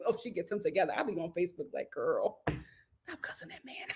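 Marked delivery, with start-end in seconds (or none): 1.9-3.9 hushed low aside, half whispered; mock whisper. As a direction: lean in and whisper it like gossip. Mock advice on Facebook, hushed.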